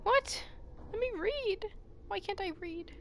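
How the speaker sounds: high, startled protest; indignant surprise. Real, not acted. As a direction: squeak it out in disbelief. Protests not being allowed to read.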